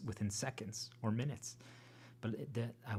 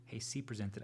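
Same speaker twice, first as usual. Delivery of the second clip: ordinary delivery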